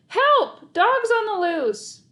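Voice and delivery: very high, loud slow scream; panicked alarm call. Acted. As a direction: scream for help. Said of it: "I screamed".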